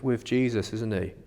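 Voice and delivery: ordinary talk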